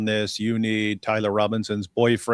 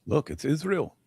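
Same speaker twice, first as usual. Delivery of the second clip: high-pitched put-on voice; hammed-up high discovery; mock conspiracy theorist; 6 semitones higher, 4 dB quieter than usual. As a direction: play a silly believer spotting a clue. Mocking conspiracy believers.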